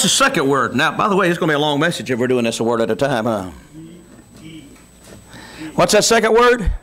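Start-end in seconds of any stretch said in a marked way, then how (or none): 5.7-6.7 pressing question. Acted. Question repeated with force.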